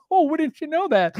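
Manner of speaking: high, slow, hammed-up innocent wonder; mock innocent surprise. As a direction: act like it is news to you. Retold feigned innocence at cashier.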